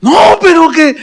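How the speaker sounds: queja aguda que se corta; protesta exclamada. Retold or imitated. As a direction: protesta en voz muy alta de tono, interrumpida. Protesta cortada ante el Señor. (réplica aguda de protesta)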